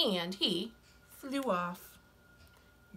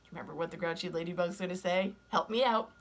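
ordinary delivery

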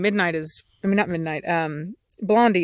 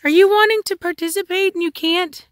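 high-pitched doting coo to a dog; 11 semitones higher, 4 dB louder than usual. Real, not acted. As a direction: tease the dog in a much higher voice. Teasing baby talk to dog.

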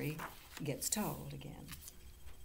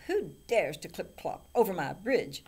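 loud bellowed challenge; bellowing troll; 9 dB louder than usual. A narrator's reading. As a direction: bellow it like a troll under a bridge. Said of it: "bellowed the troll".

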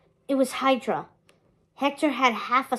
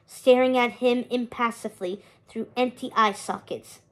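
usual speaking voice

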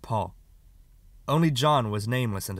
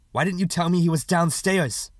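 sharp demand; 7 semitones higher, 6 dB louder than usual. Acted. Mother questions son about injury.

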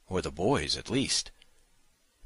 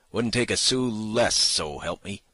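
louder, higher rough man swearing it; indignant kidnapper; 6 semitones higher, 7 dB louder than usual. A narrator's reading. Kidnapper swears to saloon keeper about the price, loud. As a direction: insist hotly, so help me.

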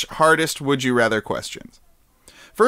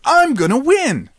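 high cartoon hero voice; high cute anime voice; 9 semitones higher, 3 dB louder than usual. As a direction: copy the eager anime hero. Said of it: "Do your best anime voice".

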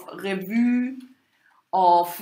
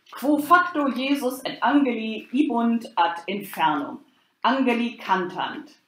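solemn Latin reading, measured and steady; liturgical recitation; 7 semitones higher than usual. Acted. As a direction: recite the sequence like a chant without singing. Recites Latin sequence instead of singing.

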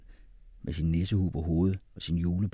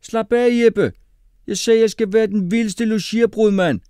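very high, loud character voice; shrieky, insistent comeback; indignant boy; 11 dB louder than usual. A narrator's reading. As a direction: shout back insistently at full pitch. Mohammed insists against Jeppe.

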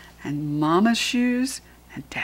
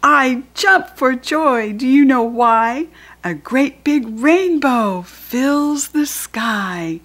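slow, higher and louder with delight; picture-book joy for a small child; joyful read-aloud; 4 semitones higher, 6 dB louder, slower than usual. Acted. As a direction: savour each word like a happy surprise. Reads the rhyme joyfully.

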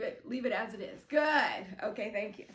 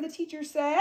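ordinary delivery

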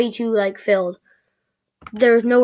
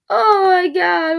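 laughing; 6 semitones higher, 3 dB louder, much slower than usual. Real, not acted. Still laughing at the ad.

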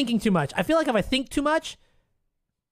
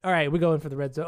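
ordinary delivery

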